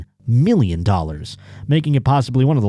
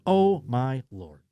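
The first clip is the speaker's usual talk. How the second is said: stunned; 7 dB quieter, slower than usual. Real, not acted. Reacting to son supporting OnlyFans.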